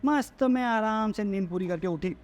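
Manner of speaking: slightly drawn-out mock bliss; lightly mimicked well-rested satisfaction; mock contentment. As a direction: savour it slowly, mocking her. Mocking woman waking after restful sleep.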